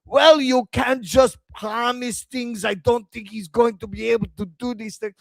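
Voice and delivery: high-pitched mock Israeli accent. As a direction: impersonate an Israeli man, voice pitched well up. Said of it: "that's when I realized he was Israeli".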